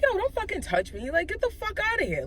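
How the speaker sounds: high-pitched indignant brush-off, not shouted; exasperated warning. Retold or imitated. Retold fending off a hug.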